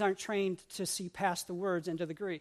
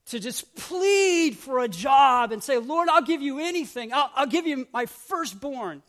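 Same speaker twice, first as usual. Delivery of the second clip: mock pleading; 4 semitones higher, 5 dB louder than usual. Mock pleading prayer to God.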